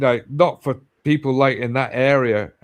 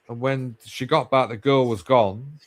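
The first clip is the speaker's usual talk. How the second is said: ordinary talk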